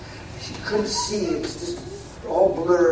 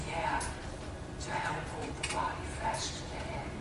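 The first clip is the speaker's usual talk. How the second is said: soft whisper, very quiet; 13 dB quieter than usual. Retold or imitated. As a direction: breathe it as a holy whisper. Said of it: "God said in a whisper".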